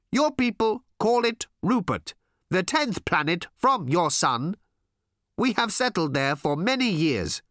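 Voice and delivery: high, slow alien voice; solemn alien explaining, word by word; loud-edged, deliberate alien delivery; earnest alien visitor. Acted.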